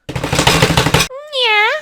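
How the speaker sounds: squeal. Acted. Reacting to drawing, high squeaky yeah.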